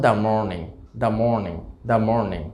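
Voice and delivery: "the morning" as "da morning", the first one slow. Shown as a demonstration. Teacher demonstrates da before morning. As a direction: model "da morning" for the class. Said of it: "আরও কয়েকটা example".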